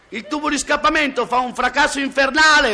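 high, sustained yell; comic straining shout on one high note; acted shout. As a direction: holler it over a roaring exhaust. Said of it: "urla lui".

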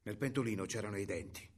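ordinary delivery

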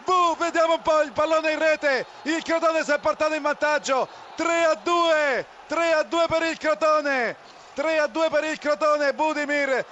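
high, building scramble call; breathless italian goal commentary. Real, not acted. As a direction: shout the goal, then repeat the score.

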